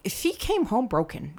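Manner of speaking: ordinary talk, nothing marked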